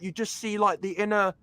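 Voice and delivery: normal talking voice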